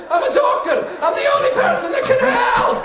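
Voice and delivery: very high, hammed-up desperate cry; mock shriek, pitch climbing wildly; desperate protest. Doctor carried out backwards, high desperate protest.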